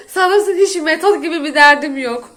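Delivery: ordinary talk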